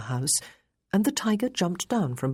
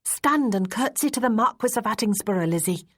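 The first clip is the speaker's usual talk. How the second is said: put-on higher-pitched young woman instructing a child; prim order from a governess to her pupil; put-on higher voice, giving an instruction; petulant young lady; 6 semitones higher than usual. A narrator's reading.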